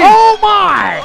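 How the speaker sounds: very high, loud astonished cry; ecstatic shriek. Real, not acted. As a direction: scream it out in amazement. Co-commentator screams at wave.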